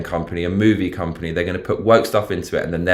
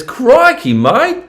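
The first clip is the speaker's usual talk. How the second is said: hammed-up Aussie, much higher; over-the-top Australian accent, pitched way up; 8 dB louder, much slower than usual. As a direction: build to a high, loud Australian exclamation. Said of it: "Rate my Australian accent".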